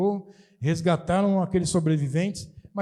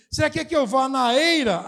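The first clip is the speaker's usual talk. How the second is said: ironic question; 6 semitones higher, 4 dB louder than usual. Retold or imitated. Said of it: "O rei tá fazendo uma pergunta pra ela irônica".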